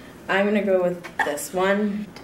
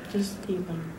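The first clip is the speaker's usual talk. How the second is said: whispering; 3 semitones lower, 11 dB quieter than usual. Real, not acted. Urging during taste challenge.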